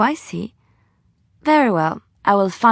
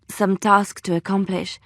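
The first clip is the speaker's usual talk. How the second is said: normal talking voice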